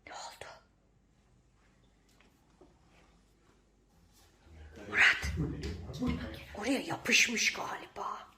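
tense hushed whisper. Real, not acted. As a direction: whisper so nobody hears. (fısıldayarak)

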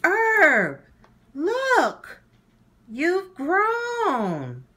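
very high, loud excited cries; bursting with joy; thrilled. Acted. Muriel and Herb discover they grew, very high excited shouts.

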